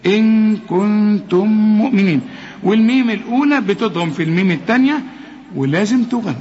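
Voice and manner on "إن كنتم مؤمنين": إن كنتم مؤمنين chanted, the ن nasalized without the tongue; measured recitation showing the nasal sound; recited with ghunna. Shown as a demonstration. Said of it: "ما تستعملش فيهم لسانك أبدًا".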